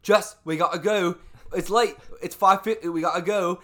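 loud, high mock-British urgency; bad British accent. Roommate hurrying Jess for PT, frantic bad British accent. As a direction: imitate a panicking roommate in a poor British accent. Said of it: "poorly accented British man".

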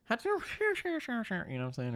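high, slow birdlike call; bird call. Retold or imitated. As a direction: chirp it like a bird. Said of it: "You'd be like".

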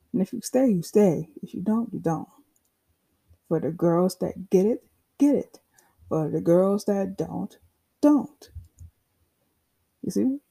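usual speaking voice

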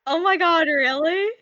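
high, loud fake astonishment; overacted shock at a silly fact; mock amazement. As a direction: gasp it out as if stunned.

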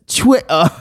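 high British "Twitter" with dropped middle t's; mock British accent. Realising what the meme says, mock British accent. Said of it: "British people be like".

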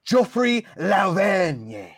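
drawn-out French-style name; sizzled name. Pretend. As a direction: savour every syllable of Geoffrey Lauvergne, slowly. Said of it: "the sizzle you put on the name".

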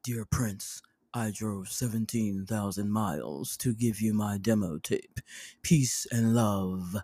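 low, soft, slow imitation of [name]; [name] reading my note. [name] reading her note aloud, low and slow. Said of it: "He's going".

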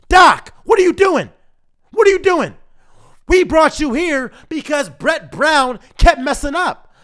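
ordinary talk, nothing marked

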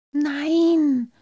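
high, squeaky stage whisper; squeaky little reply; whispered back. A narrator's reading. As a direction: answer in a high, thin voice. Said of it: "flüsterte Halbschuh zurück".

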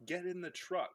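mock call, pitched high; retold yell. Jax calls Cole to the truck, mock yell. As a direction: call out high without really shouting. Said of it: "he opens the door and he yells".

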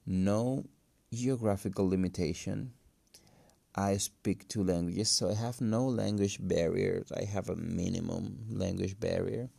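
ordinary talk

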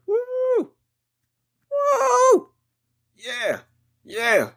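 high falsetto woo, shouted out; whooping cheer. Acted. Pumped fan hyping the Bears in the parking lot, whooping. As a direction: whoop like a crazed tailgater. Tailgate hype before the Bears game.

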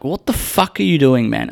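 high, indignant challenge; angry. Retold or imitated. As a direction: imitate losing it at someone. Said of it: "You get so angry".

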